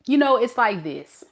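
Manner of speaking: usual speaking voice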